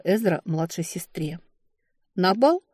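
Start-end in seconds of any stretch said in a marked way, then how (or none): 2.1-2.6 sharp, high declaration. A narrator's reading. Younger sister claims the ball, sharp. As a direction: claim it abruptly for yourself. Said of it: "резким голосом заявила та".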